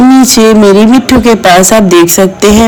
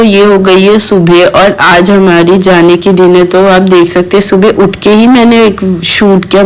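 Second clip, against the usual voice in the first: normal talking voice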